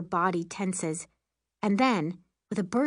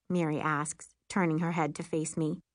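ordinary delivery, nothing marked

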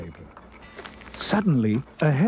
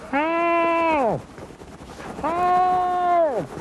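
high, drawn-out wail for help; cry for help. A narrator's reading. Eduardo calling from inside the sack, high cry. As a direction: call high as a trapped captive.

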